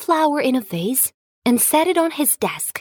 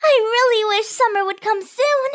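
high, eager little sprout; wide-eyed tiny seed, hopeful; 7 semitones higher than usual. Acted.

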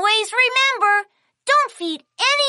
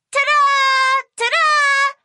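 higher fanfare, final vowel held; showy ta-da; 6 semitones higher, 4 dB louder, slower than usual. Acted. Kiki presenting her friend Puppy, showy fanfare. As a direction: present the surprise guest with a flourish.

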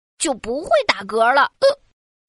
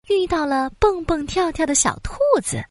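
plain reading voice

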